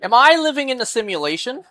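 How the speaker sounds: high, quick, baffled outburst; incredulous. Real, not acted. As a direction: ask it fast and high in disbelief. Baffled after the spelling video.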